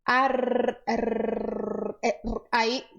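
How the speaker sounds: Ar, r dragged into a long rolling trill; r held as an endless roll; overlong trill. Shown as a demonstration. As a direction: show the trill that goes on too long. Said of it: "o que que acontece?".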